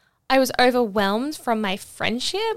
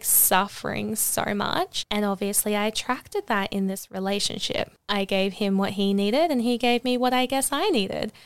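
normal talking voice

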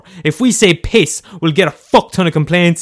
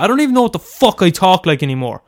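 normal talking voice

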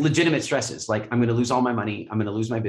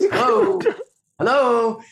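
mock calling out; 6 dB louder, much slower than usual. Calling out for a breakdown.

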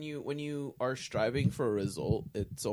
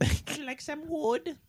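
high game character voice; 5 dB louder than usual. Acted. Minecraft villager bit.

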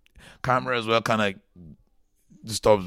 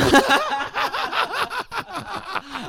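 high squealing laugh. Real, not acted. Laughs at the joke.

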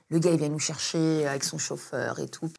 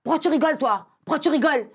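high, loud heated yelling; mock angry shout in the car; retold shouting; 8 semitones higher, 5 dB louder, faster than usual. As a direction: yell it back and forth in the car.

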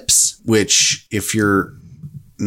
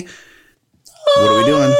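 sing-song high; much slower than usual. Pretend. Sing-song question to cohost.

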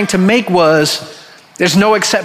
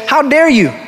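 higher-pitched mock indignation; indignant rebuke; 6 semitones higher than usual. Master calls the servant back, acted indignant rebuke. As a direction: retell an outraged master's rebuke.

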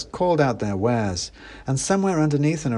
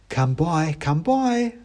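high sing-song market call; calling mouse seller; 7 semitones higher, slower than usual. Acted. A mouse hawker calls to passers-by, sing-song. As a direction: cry out your wares like a squeaky stallholder. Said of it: "called the mouse at the stall".

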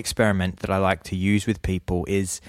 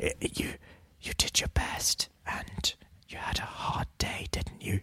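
whisper; 12 dB quieter than usual. Retold or imitated. Retelling an ASMR video to a friend, whispered. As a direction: hush it like a soothing video voice.

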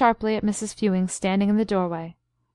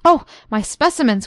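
higher, louder sudden alarm; alarmed cry; 4 semitones higher, 7 dB louder than usual. A narrator's reading. Doctor fears for his specimens, alarmed cry. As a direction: cry out in shock for your specimens. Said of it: "cried the doctor".

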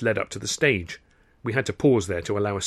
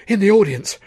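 alarmed, higher and louder; gasped; 8 semitones higher, 6 dB louder than usual. Acted. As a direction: blurt it out in shock. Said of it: "he gasped".

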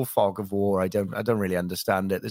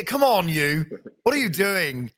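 higher, mock-angry chef bark; Gordon Ramsay; 7 semitones higher than usual. Comedian shows his Gordon Ramsay as a go-to impression, higher and shouty. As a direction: yell at a cook like a TV chef. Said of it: "sometimes he's down here, and then he's up here".

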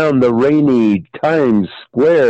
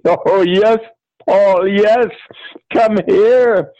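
high, breathless-lover acting; theatrical passion; 8 semitones higher, slower than usual. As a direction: give in with hammed-up high ardor. Acted seduction scene on stage.

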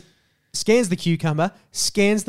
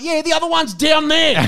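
much higher, louder mocking shopkeeper voice; scornful shopkeeper; 12 semitones higher, 8 dB louder than usual. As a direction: sneer it out loud and high.

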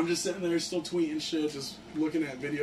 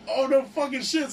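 higher mock excitement; excited; 8 semitones higher than usual. Fan shaking his idol's hand, excited. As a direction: gush like a starstruck fan, pitched up.

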